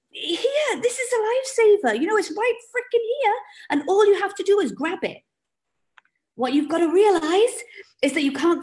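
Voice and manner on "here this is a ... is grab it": high, exasperated; pitched way up, pushing the lifesaver at them; frustrated pleading. Pretend. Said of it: "You're like".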